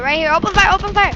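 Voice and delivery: loud, high battle cry; shouting orders. Real, not acted. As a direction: yell the order to fire over the noise. Calling fire in a dogfight.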